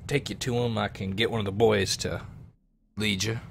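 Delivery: usual speaking voice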